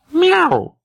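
loud high meow; cat meow. A narrator's reading. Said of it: "сказала кошка". (громкое мяуканье)

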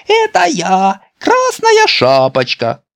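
high, slow, loud put-on girlish voice; a boy pretending to be a little girl; faked girl voice. Petya fakes a girl voice. (Петя притворяется Красной Шапочкой, писклявым голоском)

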